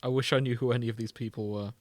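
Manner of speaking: normal talking voice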